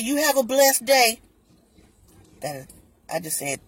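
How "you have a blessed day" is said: higher, louder and slow, sugary goodbye; mock sweet clerk. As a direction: overdo the polite farewell. Rep's parting line voiced.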